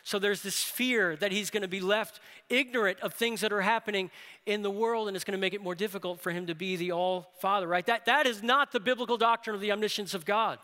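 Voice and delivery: usual speaking voice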